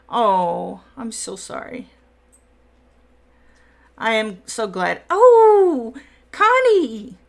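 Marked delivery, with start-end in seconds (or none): 5.1-7.2 high, loud squeal of welcome; thrilled, drawn-out shout hello; delighted greeting. Real, not acted. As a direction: call out in excited delight, pitch way up. Delighted greeting of arriving friend.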